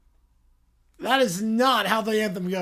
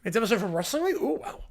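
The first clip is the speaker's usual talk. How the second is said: ordinary talk, nothing marked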